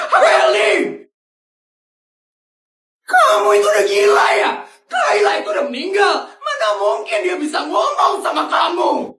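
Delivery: high furious scolding; angry shout. Acted. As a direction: yell at her she's lost it. Father yells at daughter.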